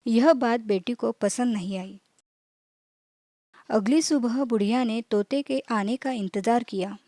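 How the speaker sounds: ordinary delivery, nothing marked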